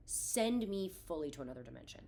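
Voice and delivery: ordinary delivery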